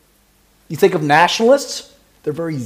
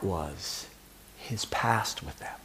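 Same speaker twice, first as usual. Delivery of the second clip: whisper; 11 semitones lower, 17 dB quieter, slower than usual. Real, not acted. Whispered aside in sermon.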